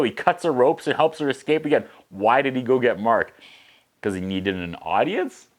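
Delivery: ordinary talk, nothing marked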